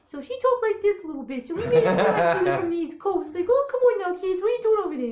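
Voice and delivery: high, loud New York mom cat; New York accent. Acted. Mom cat scolding kids, high New York accent. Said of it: "she had a New York accent".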